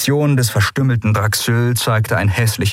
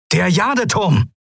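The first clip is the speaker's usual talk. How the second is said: calling out; 11 semitones higher than usual. A narrator's reading. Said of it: "rief Quailor".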